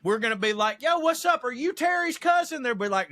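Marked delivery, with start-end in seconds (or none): 0.8-2.6 friendly greeting. Pretend. Imagined friendly southern greeting.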